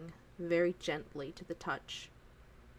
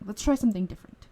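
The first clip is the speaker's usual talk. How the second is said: Let's try something different, rushed out fast and louder; quicker eager take; 3 semitones higher, 7 dB louder, much faster than usual. Acted. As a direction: hurry into the new idea. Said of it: "He goes".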